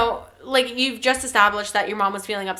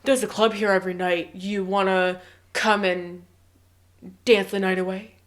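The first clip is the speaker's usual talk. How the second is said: sleazy invitation; 5 semitones lower, 4 dB quieter than usual. Pretend. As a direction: lean in with a slimy offer. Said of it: "he suddenly goes".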